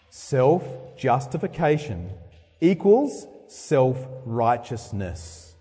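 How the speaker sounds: Self-justification equals self-righteousness, slow and weighty; slow emphasis. Shown as a demonstration. Preacher drives the point home to the church, slow. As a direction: lay each word down slowly. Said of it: "But listen carefully".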